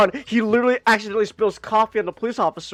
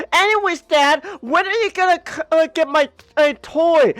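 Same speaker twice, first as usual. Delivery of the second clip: put-on high child pestering voice; a little boy nagging his dad; kid voice; 8 semitones higher, 3 dB louder than usual.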